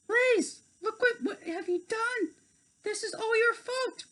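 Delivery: very high, tearful blame; upset fairy. Acted. Tink blaming Blaze, very high tearful voice. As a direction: cry it out at Blaze.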